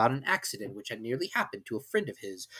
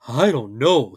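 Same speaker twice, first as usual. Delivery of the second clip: doleful; 8 dB louder, slower than usual. A narrator's reading. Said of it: "rather dolefully".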